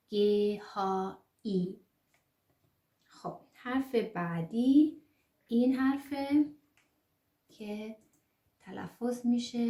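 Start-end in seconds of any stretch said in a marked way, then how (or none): none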